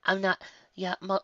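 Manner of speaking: ordinary delivery, nothing marked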